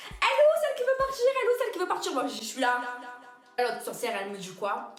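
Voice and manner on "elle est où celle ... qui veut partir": shrill bossy woman. Retold or imitated. Mocking the manager arriving.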